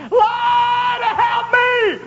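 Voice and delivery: very high, drawn-out desperate cry; acted scream. Peter sinking in the water screams to the Lord, desperate. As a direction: scream it out on long held notes. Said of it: "And he screamed".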